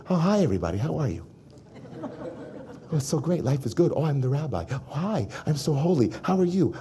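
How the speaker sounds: a hint of a syrupy holy-man act; playing the fake holy rabbi in public; fake sweet public voice. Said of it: "when I'm performing for the world".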